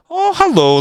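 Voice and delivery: very high, perked-up "oh, hello"; excited surprise. Real, not acted. Player spotting a chance in the match, excited surprise. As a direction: pipe up as a chance appears.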